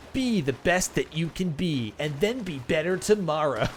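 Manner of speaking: sarcastic inspirational-poster voice; mock motivational slogan. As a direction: read the poster line like a cheesy life coach. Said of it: "it's all like".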